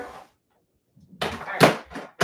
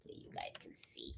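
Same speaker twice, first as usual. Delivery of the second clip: whispered aside; 25 dB quieter than usual. Real, not acted. Aside while showing the hoop lip.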